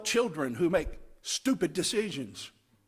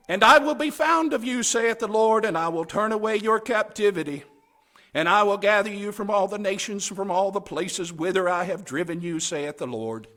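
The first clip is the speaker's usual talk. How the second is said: solemn scripture reading; 5 dB louder than usual. Acted. Reads scripture as God speaking.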